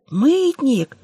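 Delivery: light high squeak of a rat; squeaky rat voice. Acted. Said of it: "пропищала из миски крыса".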